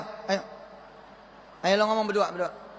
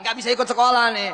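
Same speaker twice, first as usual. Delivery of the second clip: quick, louder casual brag; fast boastful line; 4 dB louder, faster than usual. Shown as a demonstration. Student announcing absence to class, fast and casual. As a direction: toss it off fast. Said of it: "Ngomongnya gitu dong".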